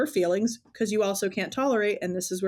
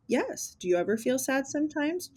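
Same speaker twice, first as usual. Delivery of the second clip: gentle mom voice; 6 semitones higher than usual. Retold or imitated. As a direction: ask the toddler kindly. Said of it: "And I'm like".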